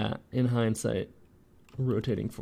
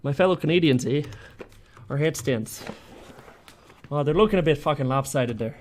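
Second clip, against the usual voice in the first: louder, hammed-up Canadian accent; 3 semitones higher, 5 dB louder, faster than usual. Modeller addressing fellow Canadians, hammed-up Canadian accent. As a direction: lay on a broad mock-Canadian accent. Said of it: "Why is my Barack Obama Canadian Southern?".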